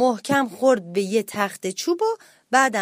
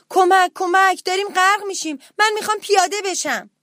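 high-pitched frightened yelling; panicked shouting; 8 semitones higher, 4 dB louder than usual. A narrator's reading. Little mouse to the crew on a rocking ship, shouting in panic. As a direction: cry out urgently to be let off. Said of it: "موش کوچولو داد زد".

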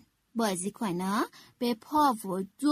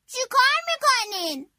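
higher, louder, curious bear voice; 9 semitones higher, 5 dB louder than usual. A narrator's reading. Bear passing by and asking what they do, a curious, higher voice. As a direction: ask in passing, genuinely curious. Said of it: "پرسید".

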